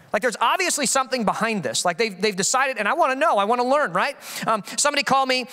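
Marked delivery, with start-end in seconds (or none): none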